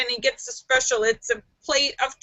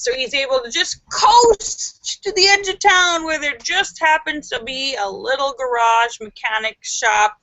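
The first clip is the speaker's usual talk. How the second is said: ordinary talk, nothing marked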